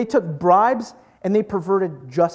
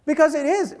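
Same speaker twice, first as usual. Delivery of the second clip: normal talking voice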